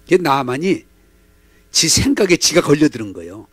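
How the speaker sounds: ordinary talk, nothing marked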